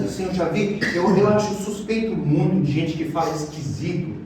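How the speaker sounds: ordinary talk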